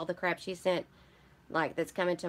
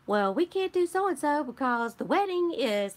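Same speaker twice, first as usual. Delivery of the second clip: higher, louder mock-fussy friend voice; playful higher imitation of a fussing friend; mimicking Candy; 8 semitones higher, 4 dB louder than usual. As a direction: lightly voice her fretting over the wedding. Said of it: "And she's like".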